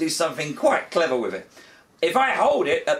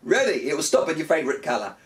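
usual speaking voice

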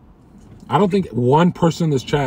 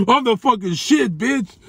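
very high, hammed-up boast; gloating triumph; 12 semitones higher, 3 dB louder than usual. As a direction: crow it high, straight out of a laugh. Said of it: "going to bed like this".

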